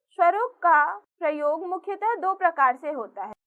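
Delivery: ordinary delivery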